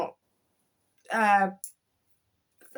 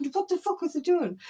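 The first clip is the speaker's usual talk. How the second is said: higher, pinched disbelief; mock shock at her own text, pitched up; incredulous self-talk; 7 semitones higher than usual. Scolding herself over the sent message, higher incredulous. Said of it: "I was going".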